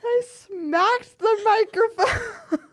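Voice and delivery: high, squeaky, teary laughter; crying laughing. Real, not acted. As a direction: talk through helpless laughter. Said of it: "tears coming out of my eyes".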